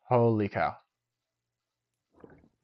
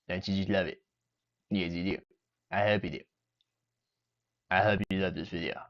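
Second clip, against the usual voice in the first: lightly voiced goofy character; a mild put-on comic voice; put-on silly voice. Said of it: "I don't know why I'm doing this voice".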